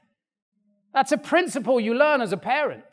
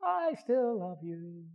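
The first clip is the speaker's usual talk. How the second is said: gentle forgiveness; 2 semitones lower, 8 dB quieter, much slower than usual. Retold or imitated. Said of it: "and she goes".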